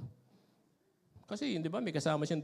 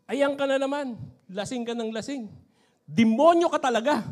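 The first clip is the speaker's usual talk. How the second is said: loud, higher nagging scold; angry wife; 6 semitones higher, 10 dB louder than usual. Retold or imitated. Wife scolds her drunk husband, loud and higher. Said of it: "sabi nung nanay".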